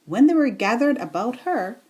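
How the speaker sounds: usual speaking voice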